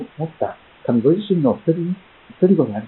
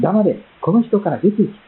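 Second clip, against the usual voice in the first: a touch of a higher, commanding rebuke; Jesus rebuking the demon, mildly stern; 6 semitones higher than usual. Acted. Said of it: "彼を叱っています".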